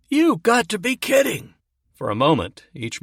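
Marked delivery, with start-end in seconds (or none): 0.1-1.5 much higher, disbelieving cry; voice rising an octave. Acted. Sergeant hears shocking news on the phone, voice leaping up. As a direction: jump way up in pitch in shock. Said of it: "The sergeant's voice rose an octave".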